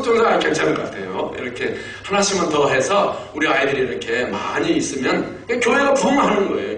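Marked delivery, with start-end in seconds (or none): 5.5-6.8 high-pitched emphatic conclusion; emphatic preaching. Real, not acted. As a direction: lift the pitch and drive it home. Emphatic conclusion, then repeated hushed. (높여 강조하는 설교조)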